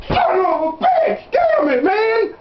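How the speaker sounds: high, half-sobbing mock lament; mock crying wail. Bill mock-wailing to his son over a stubbed toe, high, hammed-up sob.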